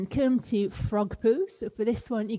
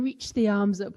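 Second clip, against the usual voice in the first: usual speaking voice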